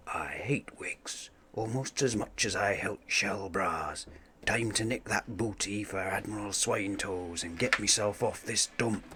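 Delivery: soft unvoiced grumbling whisper; scheming whisper. Impostor plotting alone below deck, whispered. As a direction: whisper it, no one must hear.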